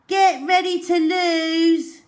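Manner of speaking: high, loud cocky taunt; boastful hare teasing the tortoise at the start. A narrator's reading. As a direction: rub it in his face.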